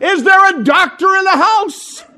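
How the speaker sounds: high loud call for a doctor. Retold or imitated. Shepherd calling out to the audience, high alarmed shout. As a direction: shout it across the room like a frantic kid shepherd. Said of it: "One of the shepherds calls out".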